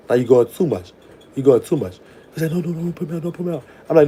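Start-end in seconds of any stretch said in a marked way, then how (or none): 2.5-3.6 higher, softer mock begging; a hint of a desperate boy pleading; Maurice pleading. A man begging her not to put him out of her cell, in a higher, softer mock plea.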